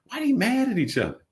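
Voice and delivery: high-pitched mock question; imitating puzzled onlookers.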